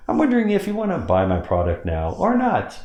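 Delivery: high pitched wondering question. Shown as a demonstration. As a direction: lift it up high, casual. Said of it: "I lower my voice when I say those words".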